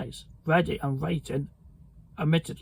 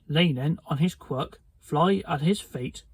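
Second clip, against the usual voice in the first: plain reading voice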